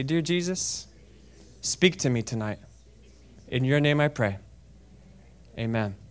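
soft, low prayer in short phrases; simple prayer. Real, not acted. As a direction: lead them gently, leaving room to repeat. Leads congregation in short prayer.

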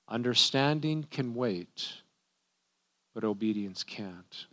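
slow and soft, with a pause midway; deliberate repetition. Real, not acted. Preacher repeats a quote worth writing down, deliberate repetition. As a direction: let each half sink in, low and unhurried. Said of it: "I'll say that a couple times".

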